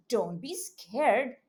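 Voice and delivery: normal talking voice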